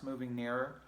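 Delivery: plain reading voice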